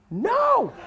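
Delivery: high-pitched mock-traumatized refusal; emphatic refusal. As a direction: refuse in a hammed-up high cry. Acted emphatic refusal to host.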